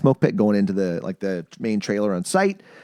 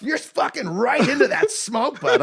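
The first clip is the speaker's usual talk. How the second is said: much higher, slow, laughing as he teases; laughing coworker; slower than usual. Retold or imitated. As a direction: tease him while cracking up. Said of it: "He goes".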